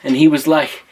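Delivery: ordinary delivery, nothing marked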